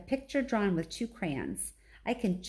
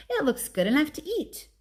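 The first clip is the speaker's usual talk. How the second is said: a lightly odd, higher voice; 8 semitones higher, 3 dB louder than usual. A narrator's reading. Ruby admiring the drawing, in a lightly odd voice. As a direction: say it strangely, a touch high. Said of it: "in an odd voice".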